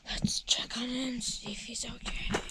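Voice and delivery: soft sneaky whisper. Real, not acted. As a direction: whisper so dad won't hear. Sneaking up on dad.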